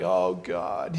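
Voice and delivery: low, soft mock despair; mock dramatic. Speaker recalling own dramatic moment to God, mock dramatic. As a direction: groan the words softly in imitated drama. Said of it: "in this very dramatic situation".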